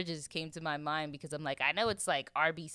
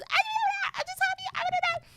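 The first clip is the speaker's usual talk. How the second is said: very high, loud wailing imitation; mock crying; 6 dB louder, faster than usual. Mom crying on the phone to her kid, high-pitched mock wail. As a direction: squeal it like a hammed-up sobbing mom. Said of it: "she was crying".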